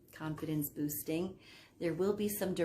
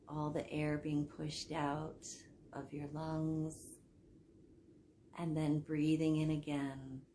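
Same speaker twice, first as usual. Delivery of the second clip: guided sleep meditation. Acted. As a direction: guide them down into sleep, softening as phrases end. Leads hypnosis for sleep.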